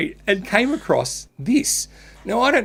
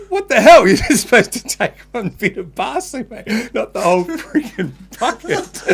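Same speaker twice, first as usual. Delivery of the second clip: higher, louder and laughing through a parents' mock telling-off; mock outrage; 6 semitones higher, 4 dB louder than usual. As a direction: ham up the parents' outrage over the bucket. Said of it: "her parents are like".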